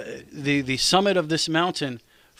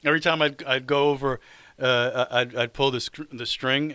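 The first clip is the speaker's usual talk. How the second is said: ordinary delivery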